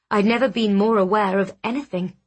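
plain reading voice

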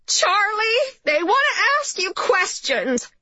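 high-pitched mother yelling from the hallway; yelled. A narrator's reading. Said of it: "yelled Charlie's mother from the hallway".

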